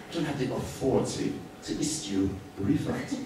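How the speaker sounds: mock officious clerk, stressing authority; stiff official tone. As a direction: imitate a flustered clerk hiding behind the rules. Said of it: "But he said it in that way".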